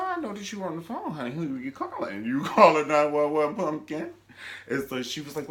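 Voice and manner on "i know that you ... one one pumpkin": higher, syrupy and threatening; high mock sweet menace. Character pressing her guest, high sugary menace. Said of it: "She said".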